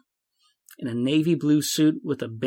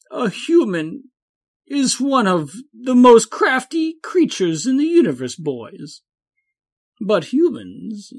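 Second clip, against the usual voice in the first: higher, slow showman drawl; rambling president voice; Champ; 8 semitones higher than usual. A narrator's reading. Champ speech continues.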